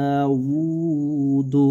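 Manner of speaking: দাউদু sung out very slowly, lips rounded on the উ; drawn-out recitation. Shown as a demonstration. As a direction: stretch দাউদু long and slow, round lips on উ. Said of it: "ঠোঁট দুটা গোল হয়ে যাবে".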